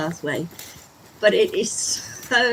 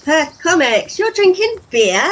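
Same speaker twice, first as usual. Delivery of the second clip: high, loud playful disbelief; mock shock; 10 semitones higher, 10 dB louder than usual. Vlogger teasing Perth Comics over a beer, mock shocked. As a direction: call out a viewer in pretend astonishment.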